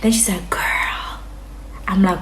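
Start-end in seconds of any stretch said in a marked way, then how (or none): 0.5-1.2 whisper. Retold or imitated. Friend whispers conspiratorially.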